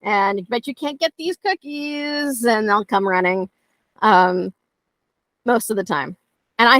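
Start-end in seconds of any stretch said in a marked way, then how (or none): 0.0-2.4 higher, playful taunting, slowing at the end; teasing taunt. Retold or imitated. Trainer taunting a dog with a stick, higher and teasing. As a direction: dangle the treat and taunt. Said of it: "hold out a stick and be like".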